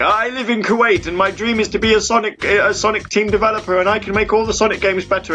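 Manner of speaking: mimicking a fan. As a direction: play the eager know-it-all fan. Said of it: "he was like".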